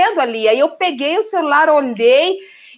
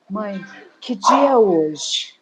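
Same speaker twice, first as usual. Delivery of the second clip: low, slow, drawn-out question; imitated daughter, suddenly slow and low; slow realisation; 6 semitones lower, much slower than usual. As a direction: act out the penny dropping, slowly.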